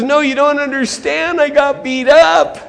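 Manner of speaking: high, pained insistence; hammed-up victim's protest; protesting injured man. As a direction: plead to be understood. Victim protesting to the church leader.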